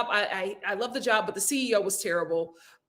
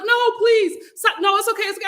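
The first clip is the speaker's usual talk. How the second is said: mock exec; 11 semitones higher, 5 dB louder than usual. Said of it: "He was like".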